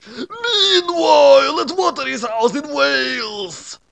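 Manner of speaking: high, loud, slow dramatic cry; shouted meanwhile. A narrator's reading. Narrator announcing the next scene, shouting. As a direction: belt the scene change like a trailer.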